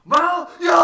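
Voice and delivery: high, full-volume yell; screaming. Acted. Disciples crying out at the sight, screaming. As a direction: let out an acted panicked yell. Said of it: "하면서 막 소리 지르는 거예요".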